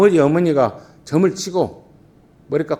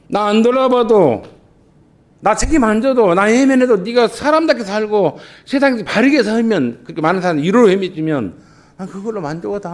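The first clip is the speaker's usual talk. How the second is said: fervent plea; 9 semitones higher, 6 dB louder than usual. Acted. Parent's selfless love, voiced fervently.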